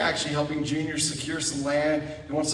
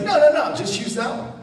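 quick insistence; 8 semitones higher than usual. Retold or imitated. Missionary insisting on other bathroom.

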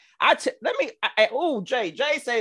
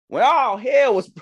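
preaching; 6 semitones higher, 7 dB louder than usual. Acted. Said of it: "Where's the organ?".

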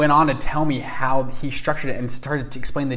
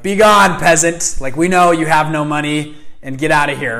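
higher, louder, haughty dismissal; snooty doorman; 3 semitones higher, 8 dB louder than usual. Pretend. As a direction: shoo away a poor visitor loudly. Imagined snooty doorman turning him away.

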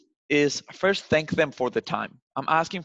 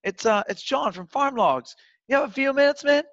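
laid-back buddy voice; 10 semitones higher, 3 dB louder than usual. Shown as a demonstration. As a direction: lean back and greet him like a pal. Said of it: "laid back on your chair, be like".

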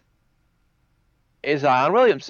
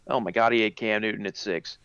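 usual speaking voice

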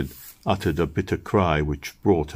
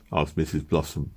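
usual speaking voice

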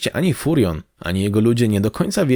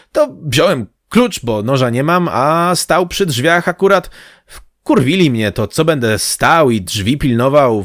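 slightly confused simple crewman; 3 semitones higher, 3 dB louder than usual. Acted. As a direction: explain yourself haltingly, then indignantly, like a flustered deckhand. (lekko zmieszany, wysoko, tłumaczy się z przejęciem)

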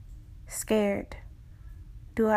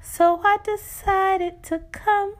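fervent, slow pleading from the reflection; impassioned spoken word; 8 semitones higher, slower than usual. Acted. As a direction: build it like a pulpit appeal.

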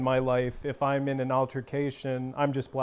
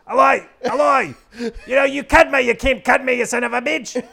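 shouted, high-pitched bad Australian accent; Blackball; 11 semitones higher, 10 dB louder than usual. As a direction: yell abuse in an over-the-top Aussie accent. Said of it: "I go into blackball".

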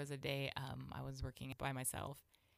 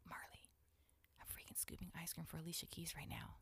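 hushed, whispered retold aside to a coworker; star-struck stage whisper; urgent whisper; 2 semitones higher, 11 dB quieter than usual. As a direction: whisper secretly so the star can't hear. Secretly telling coworker, head in case.

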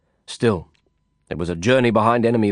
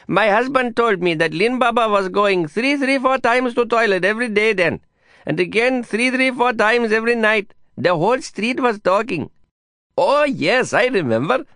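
higher, lively gossip voice; chatty neighbor woman, pitched up; 11 semitones higher than usual. Acted. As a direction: relish the scandal like a street busybody.

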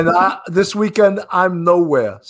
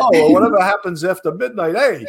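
a lightly hammed-up dismissive tone, higher on the end; mock press attitude; 4 semitones higher than usual. Host mocking how the press blames victims, lightly hammed-up and dismissive. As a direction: shrug it off like a careless reporter. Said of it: "that's the way the press is".

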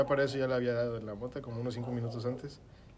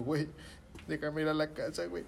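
slightly raised, pleading retelling; mock tearful plea; 4 semitones higher than usual. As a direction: lightly imitate begging near tears. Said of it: "Yo ya llorando". (súplica medio llorosa, imitada)

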